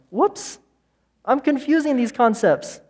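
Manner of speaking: high, startled inner voice; a sudden little "whoops" of realisation, pitched high; mock alarm. Preacher voicing the brain's alarm bell to the congregation, high mock surprise.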